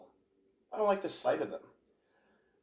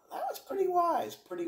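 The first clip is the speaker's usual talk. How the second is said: mock admiration.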